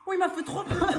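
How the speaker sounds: high, laughing through the fright; shaken laugh. Real, not acted. Gamer recovering from a jumpscare, laughing nervously. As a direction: laugh off the scare.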